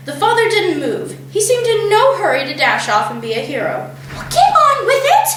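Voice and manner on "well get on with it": hissed. A narrator's reading. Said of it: "hissed the mother".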